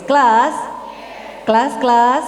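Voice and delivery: loud rising call to the class. Shown as a demonstration. Speaker to parents, calling for attention loudly. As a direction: call out to the room like a teacher. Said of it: "Saya bilang kelas".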